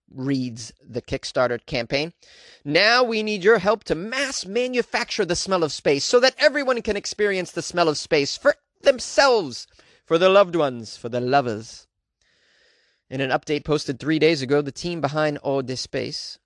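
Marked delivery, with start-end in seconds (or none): 2.6-11.9 dramatic announcer. Acted. Reads the Kickstarter pitch.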